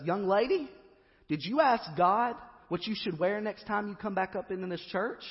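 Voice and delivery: high, loud, scolding preacher; the pastor confronting the poor young mother, loud and stern. Retold or imitated. Pastor confronting woman second time.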